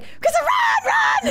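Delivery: very high squealed warning; mock panicked cry. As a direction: imitate a sister shrieking a warning. Sister urging her to flee.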